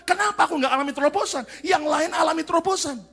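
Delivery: mock angry believer crying out; angry complaint. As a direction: shout a grievance at God. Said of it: "terus kita marah sama Tuhan".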